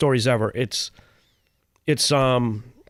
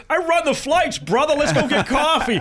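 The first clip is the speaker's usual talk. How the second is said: delighted greeting; 11 semitones higher than usual. Retold or imitated. Old buddy greets him in Iraq.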